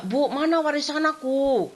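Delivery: nagging mother for inheritance, drawn out; whiny demand to mother. Retold or imitated. Streit mit Mutter ums Erbe.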